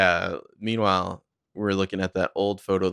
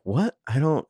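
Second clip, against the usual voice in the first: acted higher-pitched bewildered reaction; stunned confusion; 7 semitones higher than usual. Arya hearing she has won, acted stunned confusion. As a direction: break off in imitated disbelief.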